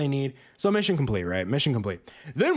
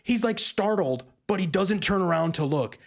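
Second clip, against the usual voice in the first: ordinary talk, nothing marked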